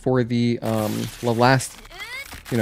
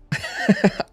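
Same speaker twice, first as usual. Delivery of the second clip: breathy laugh; 9 semitones higher than usual. Real, not acted. Laughs at gaslighting joke.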